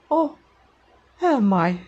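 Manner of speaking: put-on high "oh", falling through "am I"; awkward voiceover attempt at a villainess. Shown as a demonstration. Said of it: "my attempts to say this phrase".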